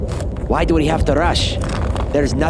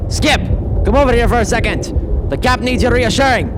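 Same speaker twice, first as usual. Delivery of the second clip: calling out; 11 semitones higher than usual. Acted. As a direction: shout for Skip to come over. Calling Skip across the ice.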